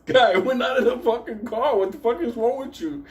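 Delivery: high, laughing voice; giggling through the teacher's outburst; barely holding back laughter; laughing retelling. Real, not acted. Teacher's exasperated line, retold laughing.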